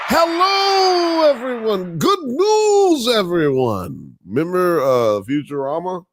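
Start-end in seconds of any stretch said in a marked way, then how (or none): 0.1-2.0 put-on high, shouted old professor voice; cracking old professor greeting; Professor impression. Professor Farnsworth impression opener. 2.0-4.2 put-on high, loud old professor voice; shouted catchphrase, high and cracking.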